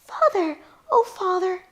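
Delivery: put-on excited young boy. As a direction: run to your father overjoyed. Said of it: "the kid you're playing".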